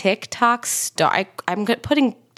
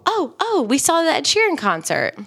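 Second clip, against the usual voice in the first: excited recall; 8 semitones higher than usual. Real, not acted. Suddenly remembering the concert.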